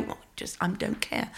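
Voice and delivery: whispered, can't-be-bothered shrug of a line; breathy whispered brush-off; muttered dismissal. Retold or imitated. Heckler's snide whisper to the comedian. Dismissive quote of audience member.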